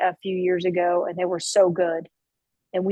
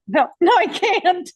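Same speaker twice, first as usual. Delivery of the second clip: laughing through it; 11 semitones higher, 4 dB louder than usual. Retold or imitated. Refusing to cry on stage.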